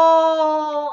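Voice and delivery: loud, high sudden realization; surprised. Real, not acted. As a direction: burst out with the discovery. Suddenly understands the trick.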